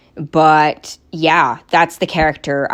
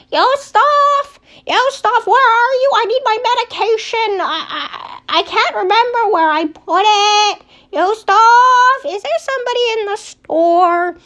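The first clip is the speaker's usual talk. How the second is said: put-on much higher old lady voice calling from afar; old grandma; 4 dB louder than usual. Grandma calls for Yustov from downstairs, high old lady voice. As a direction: cry out like a forgetful old grandmother calling upstairs. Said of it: "you can hear Grandma, like, somebody old".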